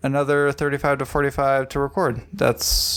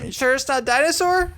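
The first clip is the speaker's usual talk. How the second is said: put-on higher teasing kid voice; mock kid; 12 semitones higher than usual. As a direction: tease like the stubborn kid. Co-host mimics kid guess.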